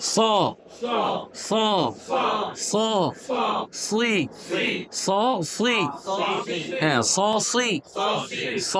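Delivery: heavy, dark "স" and "ব", the vowel backed towards "aw"; thick-letter articulation. Shown as a demonstration. Teacher drilling students on thick letters, heavy and dark. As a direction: say "স, সুই" full-mouthed and heavy, lips left natural. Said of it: "এবার মোটা হরফের জবর জের।".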